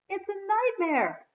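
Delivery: high, drawn-out groan of dismay; dismayed wail, stretched out slowly. A narrator's reading. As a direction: draw it out in a loud, high groan. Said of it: "Alex groaned".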